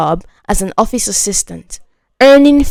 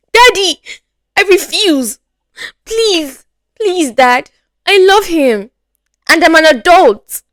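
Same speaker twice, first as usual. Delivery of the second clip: put-on much higher, pleading girl's voice; defiant pleading; 6 semitones higher than usual. Daughter to her father, high defiant pleading. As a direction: beg and defy at once. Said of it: "Ngozi is defiant".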